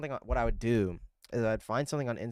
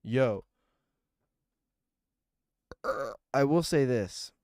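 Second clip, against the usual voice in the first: ordinary talk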